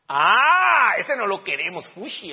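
high, loud wail easing into "fushi"; mock disgusted rejection. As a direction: recoil like a spoiled believer, hammed up. Parodia el rechazo del versículo doce. (rechazo fingido con quejido agudo)

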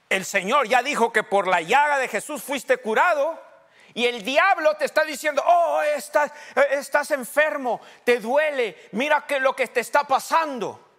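loud, high preaching cry; preaching shout. Acted. Pastor preaching faith, loud and high. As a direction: call it out to the congregation.

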